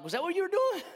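high, incredulous question; hammed-up baffled dad; mock disbelief. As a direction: ask it as if you can't believe it.

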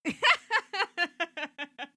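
loud burst of laughter. Real, not acted. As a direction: crack up at the joke.

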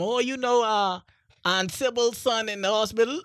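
a hint of the fussy auntie; quoting auntie. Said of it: "My auntie just come".

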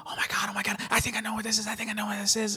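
high, hushed mock gush; mocking excited ladies. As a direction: imitate two ladies gasping with excitement, lightly. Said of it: "two fucking ladies had to ruin it where they're like".

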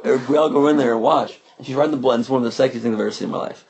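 normal talking voice

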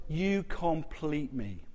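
slow, soft and tender; tender film line. Retold or imitated. She whispers her love to him as they hug, slow and soft. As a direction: breathe it out like a lover in an embrace. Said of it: "as they hug, she said".

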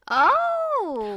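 loud, high drawn-out oh; surprised. Real, not acted. Co-host hears the immortality offer, loudly surprised. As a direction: react with big, exclaimed realization.

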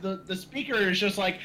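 normal talking voice

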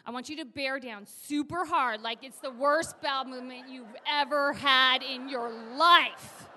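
emphatic, intensifying instruction; forceful coaching. Acted. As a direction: urge the patient to strain hard. Coaching patient to push hard.

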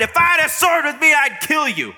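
high, fast, furious threat; shouted threat. Acted. Balaam threatening his talking donkey, furious shout. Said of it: "Balaam shouted".

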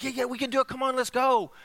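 high, eager come on; insistent coaxing. Acted. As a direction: coax him along. Friend insists.

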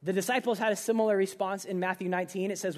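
usual speaking voice

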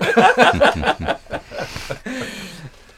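big real laugh, high; loud laughter. Real, not acted. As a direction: burst out laughing. Laughing at sponsorship joke.

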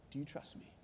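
barely voiced, very soft question; hushed, intimate whisper; God whispering. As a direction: whisper it gently into the dark. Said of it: "you can hear God whispering to him".

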